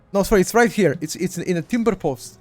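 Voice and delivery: ordinary talk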